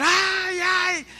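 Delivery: high, drawn-out wail of pain; imitated wife crying out. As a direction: cry out like the wife being hit. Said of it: "la esposa empezó a gritar".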